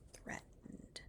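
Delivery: the word threatened breathed out in a whisper. Real, not acted. Advising viewers, ending on a whisper. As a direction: let threatened fall to a whisper.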